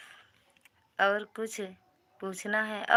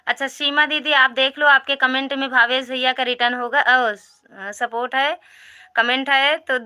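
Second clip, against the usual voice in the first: higher, louder eager announcing; calling out; 5 semitones higher, 9 dB louder than usual. Real, not acted. Streamer telling Seema to check her comments, loud eager call. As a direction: push it out loud and bright to the chat. Urging viewers on live stream.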